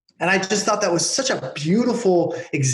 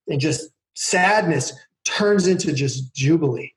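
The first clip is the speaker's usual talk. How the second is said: ordinary talk, nothing marked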